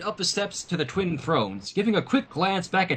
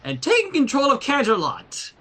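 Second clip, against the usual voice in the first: spat out; 9 semitones higher, 4 dB louder than usual. A narrator's reading. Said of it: "She spat the word out".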